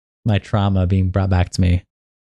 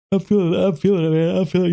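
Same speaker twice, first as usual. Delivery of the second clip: talking through a yawn; 9 semitones higher than usual. Real, not acted. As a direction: stretch it out mid-yawn. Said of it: "You see, I'm yawning already".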